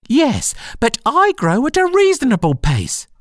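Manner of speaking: high squeaky retort; indignant Dormouse. Acted. Dormouse retorting to Alice, high indignant squeak. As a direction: argue back in a tiny high voice.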